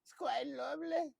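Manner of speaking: high, loud, fussy gushing voice; Papa Tarly impression. Show host imitates Papa Tarly flirting at the counter, high and gushing. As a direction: gush it like a giddy sheltered nerd. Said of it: "That's my Papa Tarly impression".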